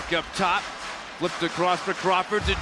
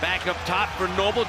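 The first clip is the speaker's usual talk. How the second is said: ordinary talk, nothing marked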